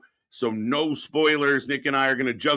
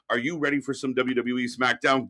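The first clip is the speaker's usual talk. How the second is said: usual speaking voice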